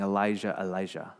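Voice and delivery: low, fading, half-swallowed mumble; mumbled. Retold or imitated. Jesus on the cross sounding like he calls Elijah, faint mumble. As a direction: let the name slur away into nothing. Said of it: "It sounded like he was saying".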